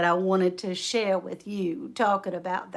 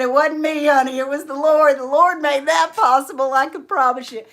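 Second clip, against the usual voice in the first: loud, higher, insistent crediting; emphatic testimony; 5 semitones higher, 9 dB louder than usual. Retold or imitated. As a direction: re-enact fervently deflecting thanks. Friend emphatically credits the Lord.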